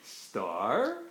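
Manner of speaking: "Star" very low, then rising slowly; questioning rise. Pretend. Parent prompting a child with "Star", low rising tune. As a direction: draw "Star" up from a low start. Word game, alternating star and turtle.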